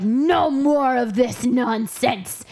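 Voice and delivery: slow mock stern order; stern slow decree. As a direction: lay down the law slowly. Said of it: "Doc Hayward's like".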